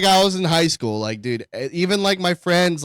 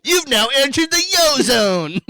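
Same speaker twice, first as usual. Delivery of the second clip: louder higher hyped TV announcer; infomercial announcer; 9 semitones higher, 4 dB louder than usual. Retold or imitated. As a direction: sell it like a cheesy ad. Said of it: "Sounds like an infomercial".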